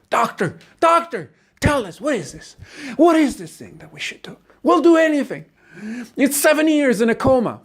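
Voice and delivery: high-pitched, desperate questioning; frantic pleading. Acted. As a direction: beg the doctor for answers in rising panic. Anxious family pleading with the doctor.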